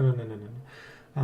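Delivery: usual speaking voice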